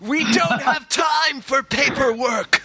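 high, drawn-out mock yell; action-hero shout. Host playing a 24 agent, mock urgent shout. Plays Jack Bauer catchphrase.

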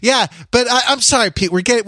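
ordinary delivery

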